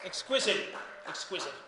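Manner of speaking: usual speaking voice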